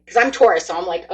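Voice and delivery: ordinary talk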